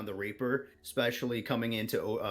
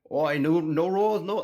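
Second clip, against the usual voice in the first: put-on botched Australian accent, higher and louder; bad Australian accent sliding towards Scottish; attempted Australian accent; 5 semitones higher, 7 dB louder than usual. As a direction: try Australian and land on cartoon dwarf. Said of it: "in an Australian accent".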